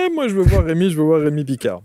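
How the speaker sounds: high, loud, eager demand; eager fans. Retold or imitated. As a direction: mimic keen viewers clamouring. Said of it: "des tas de gens qui vont dire".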